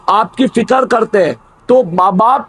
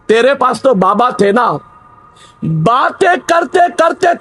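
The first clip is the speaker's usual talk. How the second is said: poem recitation. Acted. Recites a nazm in sermon.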